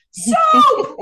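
very high, loud, explosive shout; yelling. Acted. Host delivering a listener's punchline, over-the-top mock-outraged yell. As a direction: blurt it out as a comic scream. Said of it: "I apologize for yelling at you".

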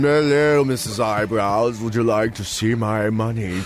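low, slow suave Bond come-on; lightly voiced smarmy Bond flirting; mock Bond.

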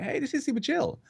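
high, light, soft quoted voice; mock first impression. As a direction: lift it high and soft, as a new player's reaction. Acts out player's first impression.

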